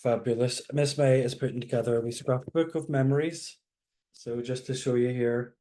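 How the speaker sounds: ordinary talk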